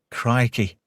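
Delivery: normal talking voice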